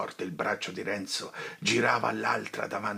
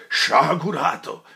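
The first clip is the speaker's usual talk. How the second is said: loud, higher cry of reproach; full sonorous cry; shouted rebuke; 8 semitones higher, 11 dB louder, slower than usual. Acted. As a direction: thunder at him. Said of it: "gridò il padre Cristoforo con una voce".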